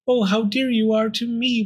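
high, heartfelt little voice; affectionate. Acted. Pearl returning the bone's affection, tender. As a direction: answer fondly, pitched high.

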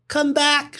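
very high call after the cone. A narrator's reading. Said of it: "shouted Cyril".

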